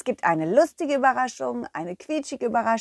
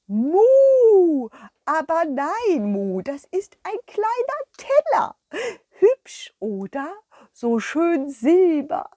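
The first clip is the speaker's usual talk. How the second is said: high, mooing cow with a proud tone; a sweet cow showing off her find; cow puppet; 7 semitones higher, 5 dB louder, slower than usual. Acted. The cow Kurita proudly presenting her little plate.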